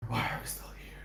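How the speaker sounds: hushed whisper, played for drama; quiet, voiceless dramatic whisper; whispered quote. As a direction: whisper it like a tragic line. Quoting a line about suffering.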